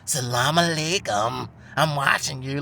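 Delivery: imitating a would-be scary watcher; lightly spooky, mocking intimidation; mock menace. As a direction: make a hammed-up veiled threat, drawing out the greeting. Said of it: "trying to do some fear shit".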